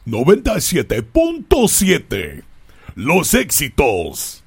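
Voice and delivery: Spanish radio voice. Shown as a demonstration. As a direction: stretch it out like a Latin station ID. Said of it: "That's my Spanish radio voice".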